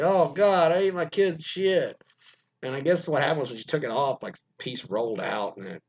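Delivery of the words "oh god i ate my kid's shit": higher, louder dawning horror; imitating a husband's shocked dismay; mock horrified realization. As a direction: say it as the awful truth sinks in. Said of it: "then he's like".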